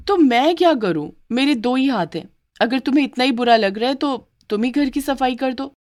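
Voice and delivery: defiant retort. Acted. Maid answers back.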